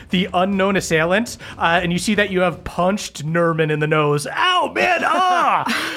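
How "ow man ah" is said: high yelping cry of pain. Acted. Punched Nerman crying out in pain, high yelp. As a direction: yelp like you just took a punch.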